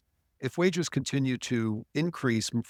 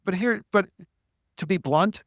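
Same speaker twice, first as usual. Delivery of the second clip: ordinary talk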